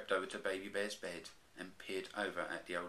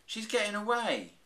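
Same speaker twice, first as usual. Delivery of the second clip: urgent; 14 semitones higher, 8 dB louder than usual. A narrator's reading. Baby Bear panicking.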